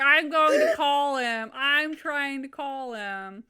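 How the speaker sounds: hammed-up frustrated mom; mock exasperated mom. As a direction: imitate mom insisting, words dragged. Acting angry mom on phone.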